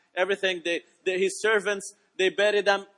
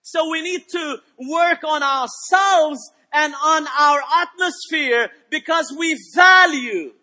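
emphatic preaching, louder on key phrases, with drawn-out words; 6 semitones higher, 8 dB louder, slower than usual. Real, not acted. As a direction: build to a raised, declaiming sermon voice. Preaches at full force.

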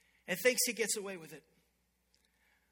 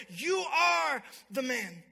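loud, very slow, stretched-out charge; acted accusation, each word drawn out; thundering accusation; 2 semitones higher, 5 dB louder, much slower than usual. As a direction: preach it as a prophet's verdict. Prophet accuses the king, acted.

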